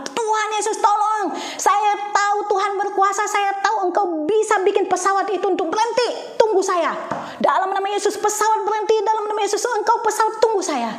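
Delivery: high, loud pleading prayer; high, loud commanding prayer for the plane to wait; frantic prayer. Retold or imitated. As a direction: beg God, voice pitched high and strained. Retold desperate prayer in traffic.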